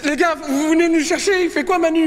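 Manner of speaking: high urgent shouting. Acted. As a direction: call out desperately for help. Desperate call for rescue.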